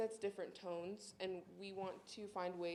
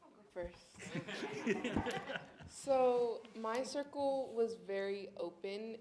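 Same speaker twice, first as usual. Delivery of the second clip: laughing; 4 semitones higher, 5 dB louder than usual. Real, not acted. Laughs while starting her answer.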